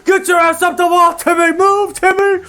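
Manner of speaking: desperate high-pitched shout at full volume; yelling. Acted. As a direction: yell it across a battlefield to a comrade. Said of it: "He yells out".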